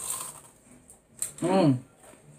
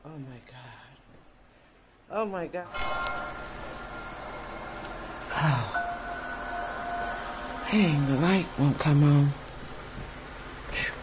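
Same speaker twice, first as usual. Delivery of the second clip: scared whisper; 5 semitones lower, 14 dB quieter than usual. Acted. Fake scare prank in dark.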